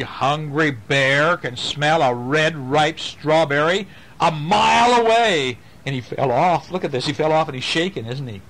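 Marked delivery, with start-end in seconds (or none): none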